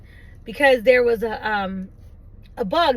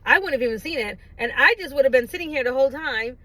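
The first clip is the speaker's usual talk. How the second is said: normal talking voice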